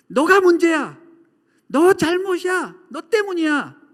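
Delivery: high, loud accusing yells; mock-furious outburst, each phrase hurled out; acted angry shouting. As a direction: shout the blame at someone. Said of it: "이렇게 소리 질러도".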